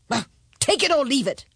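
high, quick royal ultimatum; brusque high-pitched king; impatient king. A narrator's reading. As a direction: toss out the final offer briskly.

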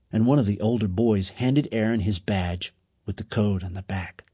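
usual speaking voice

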